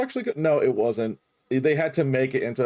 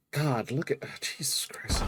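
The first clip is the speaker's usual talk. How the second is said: whispered awe; 7 dB quieter than usual. Real, not acted. Awed under breath.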